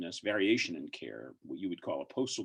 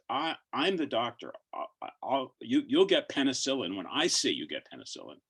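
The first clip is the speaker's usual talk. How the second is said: raised, higher voice of an insistent doctor; mock yell into the phone; 6 semitones higher, 5 dB louder than usual. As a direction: imitate a doctor yelling down the phone, mildly. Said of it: "he just yelled into the phone".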